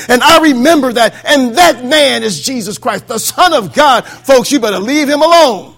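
forceful pulpit delivery building to a climax; impassioned preaching. Acted. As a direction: preach it as an urgent testimony. Builds imagined testimony in full preaching.

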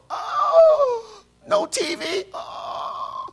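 mock wailing panic; hyperventilating. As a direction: cry out in hammed-up horror. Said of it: "I'm, I'm hyperventilating".

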